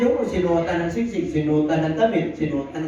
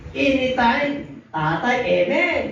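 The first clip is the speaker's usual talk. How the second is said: mock eager reply; 8 semitones higher, slower than usual. Mocking the dutiful son.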